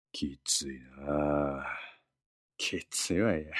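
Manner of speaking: slow, soft rueful sigh; weary sigh. Acted. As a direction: sigh it out, worn down. Katakura laments being ignored.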